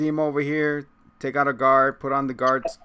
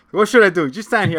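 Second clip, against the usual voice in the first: higher and louder, eager; excited quote; 7 semitones higher, 9 dB louder than usual. Retold or imitated. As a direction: blurt it out eagerly.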